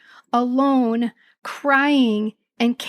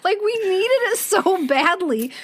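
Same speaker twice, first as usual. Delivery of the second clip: normal talking voice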